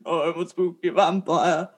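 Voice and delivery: lightly deepened spooky vampire; a hint of Christopher Lee vampire. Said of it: "I like my low voice ... Christopher Lee".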